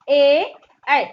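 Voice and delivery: ए then ऐ, said clearly and firmly; ए and ऐ demo. Shown as a demonstration. Teacher contrasts ए and ऐ for confused pupils. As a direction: contrast ए with ऐ from throat and palate. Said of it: "ऐ उच्चारण स्थान करिए आप लोग".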